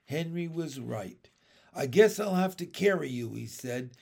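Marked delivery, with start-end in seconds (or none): 1.7-3.3 mildly grudging, higher and louder. A narrator's reading. Boy reluctantly offers the girl help, grudging. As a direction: give in with a touch of reluctance. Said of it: "his tone was grudging".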